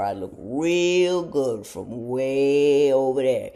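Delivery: slow, drawn-out comic tease; slow punchline. Real, not acted. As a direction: stretch it out to land the joke. Comic self-deprecating punchline.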